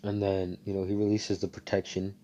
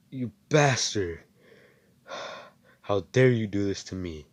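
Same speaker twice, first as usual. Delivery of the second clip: angry curse; 5 dB louder than usual. Retold or imitated. Stabbed hero curses attacker.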